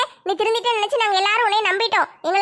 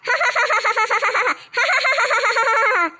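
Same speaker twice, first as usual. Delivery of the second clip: ghostly laugh; 3 semitones higher than usual. Acted. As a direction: cackle like a possessed woman. Possessed woman laughs.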